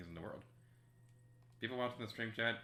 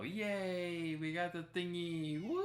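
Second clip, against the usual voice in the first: excited; 9 semitones higher, 4 dB louder, slower than usual. Real, not acted. Cheering the find.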